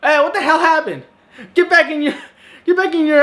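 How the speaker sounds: high, fast mock panic at the bounty hunter; hammed-up shout of disbelief; mock alarmed yell.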